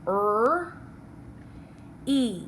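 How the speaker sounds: "R" as a lone er sound, then "E" as long ee. Shown as a demonstration. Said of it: "How can you write the syllable re?".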